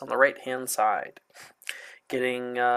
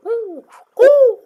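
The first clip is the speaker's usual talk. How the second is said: put-on peeping baby chick; 10 dB louder than usual. Chick noises.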